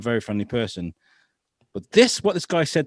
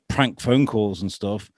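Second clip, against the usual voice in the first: usual speaking voice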